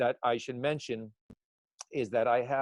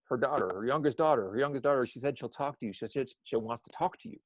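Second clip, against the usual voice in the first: usual speaking voice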